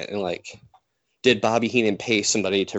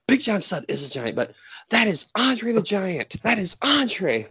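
high, insisting it's Andre; imitating an outraged commentator; hammed-up exasperation; mock insistent Heenan; 10 semitones higher than usual.

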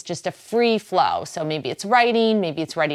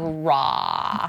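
Boston accent. Shown as a demonstration. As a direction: draw with a Boston vowel. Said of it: "can you hear my accent when I say that".

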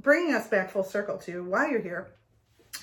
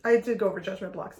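ordinary talk, nothing marked

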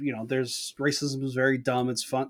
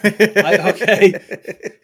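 laughing through the words; cracking up mid-sentence; 6 semitones higher, 10 dB louder, much slower than usual. Real, not acted. Laughing at Zach's plan.

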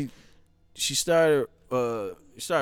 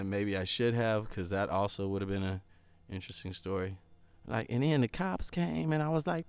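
ordinary talk

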